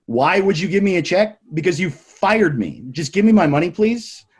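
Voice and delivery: loud, high-pitched demand; retold indignation. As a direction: act out demanding what you're owed. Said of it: "I'm not holding back at all".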